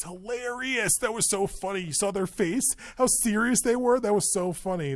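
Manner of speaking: high, increasingly loud gushing; acted amused excitement. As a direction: rave like an amazed onlooker. Imagined onlooker raving about hilarity.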